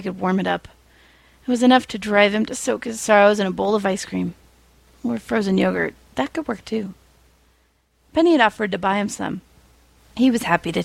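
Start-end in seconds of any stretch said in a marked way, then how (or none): none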